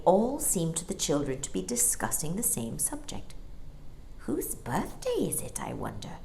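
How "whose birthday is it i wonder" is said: hushed wondering whisper. A narrator's reading. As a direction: breathe it out softly. Michael wonders softly.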